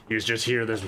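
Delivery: normal talking voice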